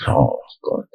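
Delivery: breathless whispered oh God; a soft unvoiced groan of dread. Real, not acted. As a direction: murmur it under your breath in dread. Dread at coming punishment.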